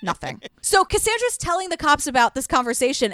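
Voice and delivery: ordinary delivery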